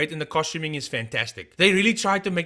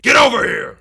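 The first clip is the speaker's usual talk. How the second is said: loud, higher, unbroken shout; straightforward shouted catchphrase; 7 semitones higher, 10 dB louder than usual. Retold or imitated. Vlogger showing how he just said it, loud imitated shout. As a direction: shout it straight through in one go. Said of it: "He just said".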